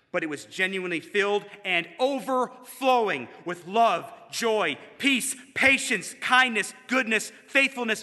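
high, loud, emphatic proclamation; rousing preacher's climax; preaching at full force. Real, not acted. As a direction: build a ringing list, punching each word.

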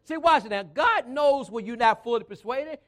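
loud, emphatic sermon build; forceful preaching. Real, not acted. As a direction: hammer the key words to the congregation.

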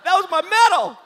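very high, loud comic bawling; mock wail. Husband answering his wife's praise, mock wail. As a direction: shriek it as a hammed-up lament.

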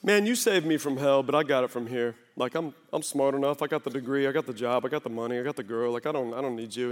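mock cocky believer muttering his own resume; proud self-reliant voice. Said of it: "We're like".

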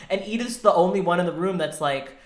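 normal talking voice

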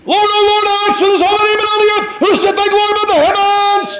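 high, soaring psalm recital; lofty, ringing recitation of praise; grand scripture reading. Acted. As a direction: proclaim the psalm like a herald. Preacher reads psalm with flourish.

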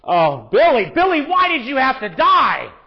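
high, loud mock wail of grief; heaven's voice crying for Billy Graham; God mourning Billy. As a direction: play God grieving over Billy, hammed up. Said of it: "He's just going".

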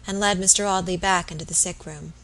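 plain reading voice

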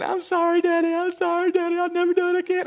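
very high, loud mock whine; whining voice. Daughter apologizing to Daddy, hammed-up whining. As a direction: plead like a whiny, tearful child. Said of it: "in a whining voice".

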